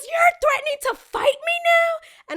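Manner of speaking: very high, slow, wailing shout; mock hysterical shriek. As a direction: screech it like an outraged, overdramatic customer. Mocks guest acting threatened.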